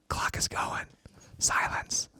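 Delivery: hushed whisper as the clock starts; game host whispering so as not to break the silence. Real, not acted. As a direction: breathe it out, no voice at all.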